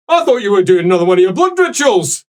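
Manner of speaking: hammed-up grumpy priest voice; gruff angry old priest. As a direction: bellow like an outraged old cleric. GM voices angry Father NPC.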